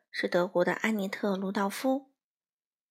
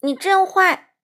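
much higher, much louder bratty shout; childish yell; 8 semitones higher, 9 dB louder, slower than usual. A narrator's reading. Crow yelling at the mother, childish yell. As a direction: shout it back at her. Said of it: "花袜子冲欢妈妈大喊".

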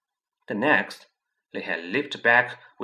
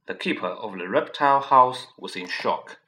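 plain reading voice

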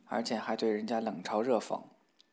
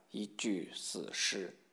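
very slow, softer, word by word; ghoulish mockery; 3 semitones lower, 8 dB quieter, much slower than usual. A narrator's reading. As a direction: draw out the insult in a hushed voice. Princess mocking a pale suitor. (慢而轻声地一字一顿嘲讽)

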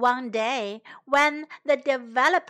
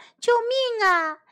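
shouting for help; 8 semitones higher, 3 dB louder than usual. A narrator's reading. Little birds call for rescue from developers, shouting. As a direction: call urgently for rescue, voice lifted. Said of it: "小鸟们也喊着".

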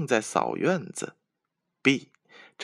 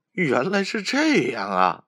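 a little higher and louder, amused relief; laughing relief; 6 semitones higher, 4 dB louder than usual. A narrator's reading. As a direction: chuckle it out, relieved. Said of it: "笑着说". (小伙伴们笑着松了口气，稍高声)

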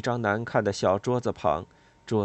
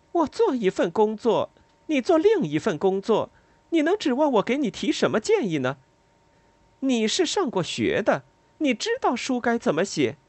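higher, louder bitter retort; resentful, unsparing; 12 semitones higher, 4 dB louder than usual. A narrator's reading. Lila resents the narrator asking her advice, bitter and cutting. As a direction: throw the words back with resentment. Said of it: "不留情面、带着怨恨的语气".

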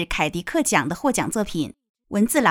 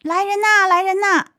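very high acted call for help; servant crying out; 10 semitones higher, 4 dB louder than usual. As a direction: play a servant shouting for someone to come. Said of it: "侍从大喊".

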